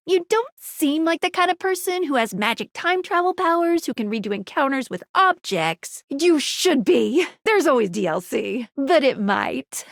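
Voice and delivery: normal talking voice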